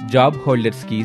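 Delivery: ordinary delivery, nothing marked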